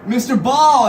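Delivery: imitated delivery man hailing someone; calling out a name. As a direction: call out for the customer.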